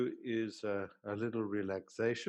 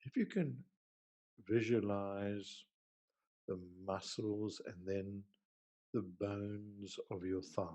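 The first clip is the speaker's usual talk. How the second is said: guided meditation; 2 semitones lower than usual. Acted. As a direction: ease them gently down, leaving space between phrases. Leads a guided relaxation.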